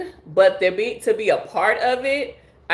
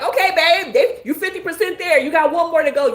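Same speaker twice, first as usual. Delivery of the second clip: higher, bright encouraging partner's voice; cheering partner; 9 semitones higher than usual. Acted. Partner cheering a small weight loss win, higher and encouraging. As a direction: cheer your babe on for losing one pound. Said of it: "and you're like".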